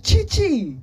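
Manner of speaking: loud, high-pitched shout. A narrator's reading. Boy summoning his friend from out back, shouting. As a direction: shout the name through a door. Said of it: "turned to the back door and shouted".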